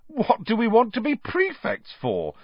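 high-pitched disbelief and distaste; revolted. A narrator's reading. George asked about being a prefect, revolted. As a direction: recoil from the very idea. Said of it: "looking revolted at the very idea".

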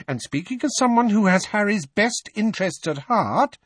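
high-pitched, insistent woman's voice; indignant mother. A narrator's reading. Mrs Weasley, about to be overruled, makes her case indignantly. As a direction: stand your ground, high and forceful.

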